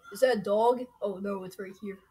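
ordinary talk

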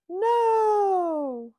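high sharp cry of no; shouted no. A narrator's reading. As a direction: cry out in protest. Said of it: "she says".

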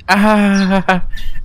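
drawn-out awkward "ahaha"; awkward laugh. Acted. Player voices a failed chat-up at school, awkward laugh.

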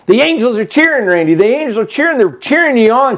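high, urgent imitation of her excitement; imitated exultant announcement; excited retold cheering. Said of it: "She kept on saying that".

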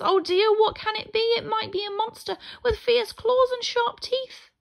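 high, loud panicky mice; frightened squeaking. A narrator's reading. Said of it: "all started to squeak at once".

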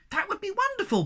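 very high, squeaky delight; bursting out. A narrator's reading. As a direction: burst out in piping excitement. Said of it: "burst out Sniff".

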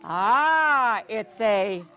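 usual speaking voice

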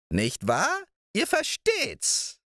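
put-on high, slow crooning old witch voice; old moor witch. A narrator's reading. Old witch cooing over Balthasar's hen, high crooning voice.

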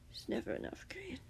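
soft, half-whispered villain line; slow. A narrator's reading. The captor answers Grian's plea, slowly. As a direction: let it out slowly, hushed. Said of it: "the man said slowly".

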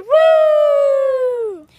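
excited cheer. Real, not acted. Cheering the outro.

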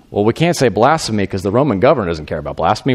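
ordinary talk

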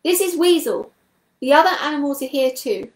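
high, loud announcement; calling out. A narrator's reading. Weasel answers the voice in the tunnel, calling out. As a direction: call back so it carries down the tunnel. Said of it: "Then he called out".

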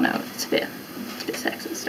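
unvoiced whispered aside; whispering. Real, not acted. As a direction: whisper it under your breath. Whispered aside about the question.

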